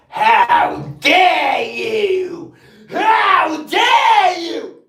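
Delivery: slow, trembling furious shout; shaking with anger. Retold or imitated. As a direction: shout it out, drawn out in outrage. Mocking Renee's outraged reply.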